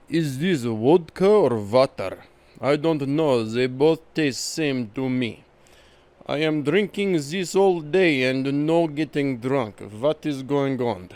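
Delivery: mock Russian accent, V's and W's swapped; a thick-accented Russian tough guy; hammed-up Russian gangster accent. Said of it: "a Russian gangster stereotype who pronounces my V's and W's backwards".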